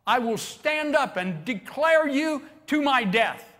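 loud, slow, high declaration; bold vow. Acted. Peter vowing loyalty before the people, loud and proud. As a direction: swear it proudly before everyone. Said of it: "and said".

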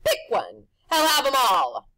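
loud, high showman pitch; pushy grinning tempter; Rictus's brash voice. A narrator's reading. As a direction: sell it like a carnival barker.

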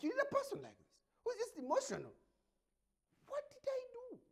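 high whiny mock voice; 1 mock high whine. Host mocking emotional supporters, high whine. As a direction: whine it up high like a hysterical fan.

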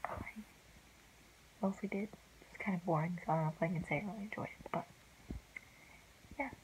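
late-night hushed whisper. Real, not acted. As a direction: keep it hushed, others asleep.

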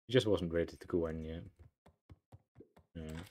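usual speaking voice

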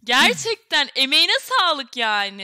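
excited. Real, not acted. Praising the singer.

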